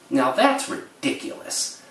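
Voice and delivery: lightly voiced, slow disapproving old lady; slow scoff from an old lady; a hint of scolding grandmother. Imitating the grandmother dismissing the news, mildly stern.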